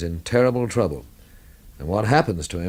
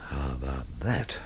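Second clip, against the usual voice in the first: much softer, low astonished aside; surprised murmur; 6 semitones lower, 11 dB quieter than usual. Acted. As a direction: let the name sink in quietly. Hearing a surprising married name.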